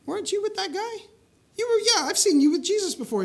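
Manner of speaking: high, pushy voices pressing Peter; a lightly voiced crowd of bystanders; accusing bystanders. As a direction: needle him like a nosy crowd.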